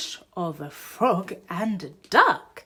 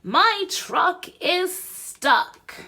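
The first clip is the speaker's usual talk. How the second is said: higher, louder and slow; angry complaint; 11 semitones higher, 8 dB louder, slower than usual. Acted. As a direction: complain with each word pressed out. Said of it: "he snaps".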